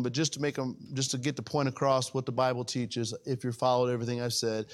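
ordinary talk, nothing marked

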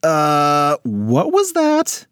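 high, slow disbelief; drawn-out baffled question; stunned. Acted. Alex stunned by mom.

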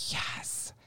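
near-whispered, retold yes; emotional. Student answering her advisor, whispering with emotion. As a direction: barely breathe the answer. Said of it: "It was very emotional".

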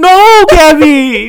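long, loud, high wailing no; drawn-out protest. Real, not acted. Host groaning at Gabby for picking Twilight, drawn out and loud. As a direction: groan it out in mock despair. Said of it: "reacting to Gabby's answer".

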